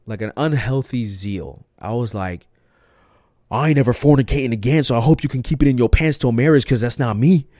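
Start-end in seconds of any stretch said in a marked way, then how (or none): 3.5-7.5 zealous lecture. Retold or imitated.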